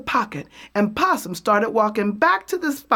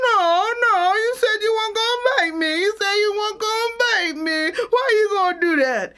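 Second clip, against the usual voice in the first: high, fast, frantic pleading; panicked squeal; 11 semitones higher, faster than usual. Acted. Possum panicking as Snake threatens to bite, high and fast. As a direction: squeal in terror.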